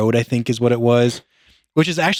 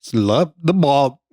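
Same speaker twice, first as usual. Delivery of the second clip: backwards speech; much slower than usual. Shown as a demonstration. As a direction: sound it out slowly as reversed. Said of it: "this might not come out right".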